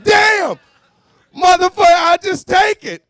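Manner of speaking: high, loud exasperated yell; defeated outburst. Pretend. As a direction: cry out in defeat. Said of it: "You're like".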